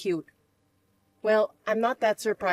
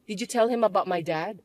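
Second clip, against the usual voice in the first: ordinary talk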